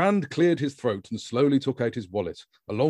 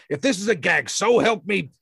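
much higher, louder threatening heavy; serious threat; 9 semitones higher, 6 dB louder than usual. A narrator's reading. The man threatening Grand, high and loud. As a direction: threaten him hard. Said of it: "to show how serious he was".

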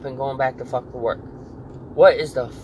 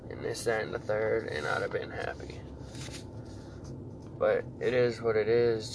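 much softer, low, half-whispered trailing off; defeated regret; 4 semitones lower, 12 dB quieter than usual. Real, not acted. Admitting to his viewers he should have kept fighting, soft defeated murmur. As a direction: let the words die away in quiet defeat.

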